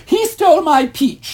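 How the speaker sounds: put-on very high, loud tattling girl voice, laughing; mock whiny sister, half laughing; whiny accusation. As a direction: tattle like a sly little sister. Sister accusing, retold quarrel.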